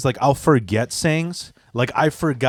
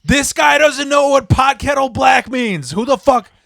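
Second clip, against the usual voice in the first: much higher, louder, slow mock yell; retold screaming; 11 semitones higher, 6 dB louder, slower than usual. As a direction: scream it like a public shouting match. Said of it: "we were screaming at each other".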